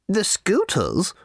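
slow, high stern little lady; lightly voiced severe shopkeeper; a hint of a stern little lady. A narrator's reading. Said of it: "said the little lady severely".

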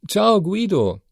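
high call from the kitchen; wife calling a greeting from the kitchen. A narrator's reading. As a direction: greet him from another room. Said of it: "rief Paola aus der Küche".

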